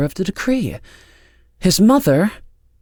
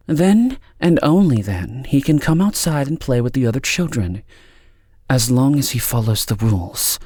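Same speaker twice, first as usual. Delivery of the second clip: cool, higher-pitched authority; 6 semitones higher, 4 dB louder than usual. A narrator's reading. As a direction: lay down the rules with deliberate pauses. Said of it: "and in a cool voice said".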